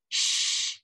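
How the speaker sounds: long hushing "shh"; shushing. Acted. April to someone at the back door, shushing.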